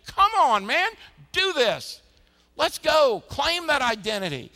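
high, loud, driving call to action; rallying shout. Pretend. God urging the church on, a high loud rallying shout. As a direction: shout them into the game like a coach.

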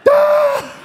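high laughing shriek. Retold or imitated. Dad in the car hearing the wrong song, a high laughing shriek.

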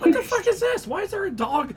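high-pitched, baffled outburst; retold disbelief, pitch leaping up; incredulous. Shopper reacting to a loose dog in the aisle, incredulous. Retelling shock at seeing a dog.

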